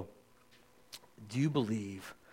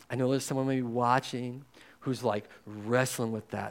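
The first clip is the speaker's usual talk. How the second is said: earnest spoken prayer, a bit louder; 4 dB louder than usual. Acted. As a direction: lead the church in prayer. Closing prayer to the congregation.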